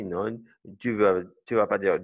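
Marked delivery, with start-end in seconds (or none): none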